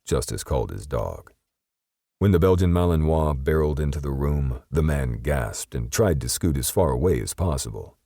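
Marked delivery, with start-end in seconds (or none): none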